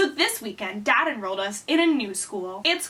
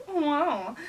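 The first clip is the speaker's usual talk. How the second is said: mock-impressed teachers; 4 semitones higher than usual. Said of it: "and they were like".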